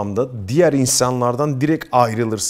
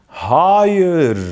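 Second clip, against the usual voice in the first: much higher, louder, blurted "Hayır."; uncultured "Hayır"; 8 semitones higher, 6 dB louder than usual. Retold or imitated. Mocking how an untrained speaker sounds among cultured people. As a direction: blurt "Hayır." like someone who never took diction. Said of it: "diksiyonla hiçbir alakanız olmadığını".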